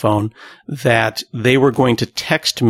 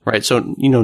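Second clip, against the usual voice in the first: usual speaking voice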